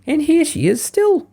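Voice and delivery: put-on very high, slow baby bear voice; joyful squeak. A narrator's reading. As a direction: squeak it out like a delighted cub. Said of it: "squeaked the Baby Bear joyfully".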